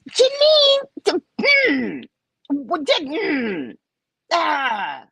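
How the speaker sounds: high mock-crying whine of a man; mocking a man's babyish fuss; childish whining. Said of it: "It's so childish".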